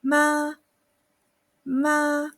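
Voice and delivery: MA held long on a high, level pitch; Ma sung out flat and high, unwavering; first tone. Shown as a demonstration. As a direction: keep Ma planito, high and steady with no movement. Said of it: "El primer tono es planito, la voz queda ahí".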